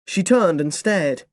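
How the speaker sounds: ordinary delivery, nothing marked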